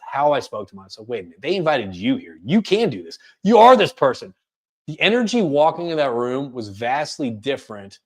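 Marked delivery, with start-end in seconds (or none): 1.1-4.3 self pep talk. Retold or imitated. Speaker retelling talking to himself before a meeting, building encouragement. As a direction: talk yourself up, punching the last line. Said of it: "changed how I talked to myself".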